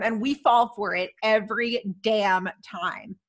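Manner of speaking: ordinary talk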